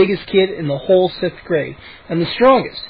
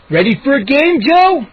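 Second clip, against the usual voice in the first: eager; 7 semitones higher, 4 dB louder than usual. A narrator's reading. Moose challenges Joe.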